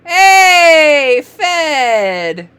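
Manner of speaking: loud, high, drawn-out cheer; excited reveal. Shown as a demonstration. Teacher cheering a reader's word, loud and excited. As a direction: celebrate the answer loudly.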